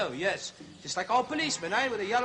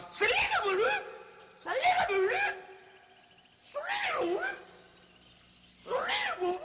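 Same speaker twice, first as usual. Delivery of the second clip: much higher, yelled name over and over; shouting the name. Acted. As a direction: yell the name as if across a noisy room. Host shouting the name repeatedly.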